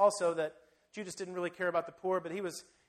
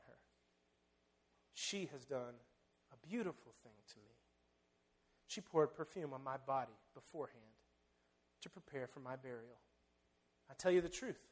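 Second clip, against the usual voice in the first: calm authority; 6 semitones lower, 7 dB quieter than usual. Acted. Jesus quiets the disciples and defends her, low and calm. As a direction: gently silence the crowd.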